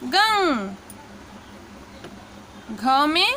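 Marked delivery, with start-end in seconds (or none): 0.0-0.7 खंग... no, गंग said slowly with a clear nasal ng; drawn-out teaching demo. Shown as a demonstration. Teacher demonstrating गंग to students, slow and clear. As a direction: sound out गंग for the class. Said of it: "अंग की मात्रा".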